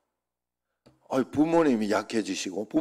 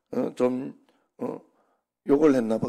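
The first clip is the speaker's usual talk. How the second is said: ordinary talk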